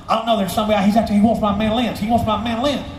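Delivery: put-on high-pitched fretful old-timer. Musician reporting a stranger at his door, fretful. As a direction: complain down the phone in a high caricature. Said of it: "I hear him pick up the phone".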